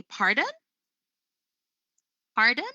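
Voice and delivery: "Pardon" as a question, pitch climbing through the word; rising intonation. Shown as a demonstration. Trainer demonstrating asking for a repetition, rising intonation. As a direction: ask "Pardon" with a clear upward lift at the end. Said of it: "starting with asking for a repetition".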